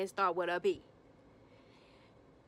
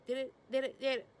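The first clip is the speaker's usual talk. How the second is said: high, stammering mock questions; flustered questioning; 5 semitones higher, 4 dB louder, faster than usual. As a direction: imitate her sputtering questions in a raised pitch. Megan voiced firing flustered questions.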